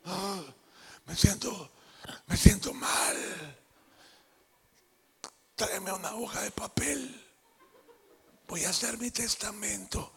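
slow, whispered mock deathbed whine; dying sick husband. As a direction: ham up a man dying of a cold. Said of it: "los hombres cuando se enferman parece que se van a morir". (marido enfermo susurrando como si se muriera)

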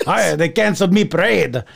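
put-on botched Irish leprechaun accent; leprechaun brogue. Angry leprechaun greeting his friend Jay, bad mock Irish brogue. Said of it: "It's a brogue".

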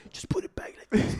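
hushed whisper at night. Retold or imitated. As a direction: whisper so no one wakes.

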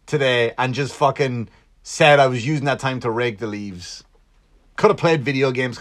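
ordinary talk

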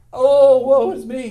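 loud, high, slow hammed-up wail; hammed-up woe; mock lament. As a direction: overplay the self-pity. Mock self-pity quote.

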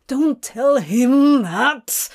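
loud, drawn-out whine of protest; annoyed whine. A narrator's reading. Demon to angel who gave him away, annoyed whine. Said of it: "Crowley whines in annoyance".

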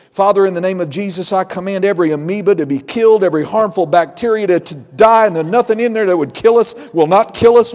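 loud, forceful prayer of command. Retold or imitated. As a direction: order the germs to die, full authority. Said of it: "listen to him pray over meals".

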